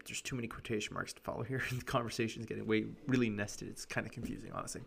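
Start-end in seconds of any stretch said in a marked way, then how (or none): none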